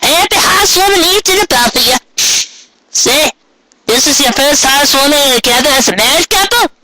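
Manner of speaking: a chirpy country grandma; old Granny Smith voice. Acted.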